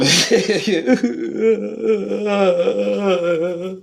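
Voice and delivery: laughing hard, high; long high laugh. Real, not acted. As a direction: crack up for a few seconds. Long laugh at own bit.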